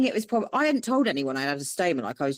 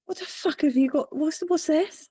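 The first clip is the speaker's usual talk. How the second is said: higher, lightly voiced shocked boyfriend; mock baffled boyfriend; 10 semitones higher than usual. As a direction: play him picking up the lanyard, puzzled. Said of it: "he picks them up and he went".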